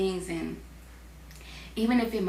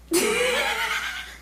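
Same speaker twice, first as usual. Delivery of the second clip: squealing laugh; real laugh; high giggle. Real, not acted. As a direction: giggle helplessly. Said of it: "Once I start laughing".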